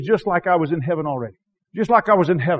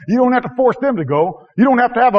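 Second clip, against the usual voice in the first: usual speaking voice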